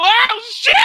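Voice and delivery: high loud yell of fright; panicked shout. Retold or imitated. Passenger seeing the train, yelling high in panic. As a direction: scream it as the train comes.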